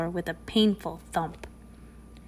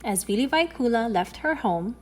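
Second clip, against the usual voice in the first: ordinary delivery, nothing marked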